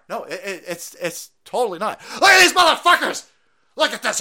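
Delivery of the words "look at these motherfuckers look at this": high loud fake alarm; mock outrage. Mocking the media's panic, loud mock alarm.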